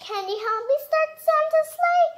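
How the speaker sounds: storybook character plea. Acted. Parent reading, character asks child to help Santa. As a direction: plead sweetly for help.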